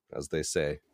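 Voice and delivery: ordinary delivery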